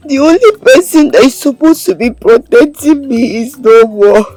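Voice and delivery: crying. Acted. Orphan telling her comforter nobody protects her, crying. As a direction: pour out the grief through sobs, haltingly. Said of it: "Stop crying".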